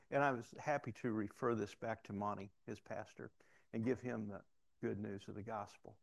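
ordinary delivery

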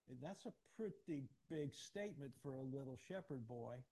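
ordinary talk

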